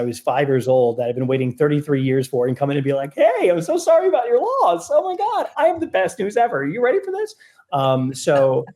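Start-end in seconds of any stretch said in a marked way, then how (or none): 3.1-7.4 much higher, gushing fake-cheerful voice; mock beaming. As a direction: burst in giddy over good news at a wake. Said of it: "did not want to come in beaming".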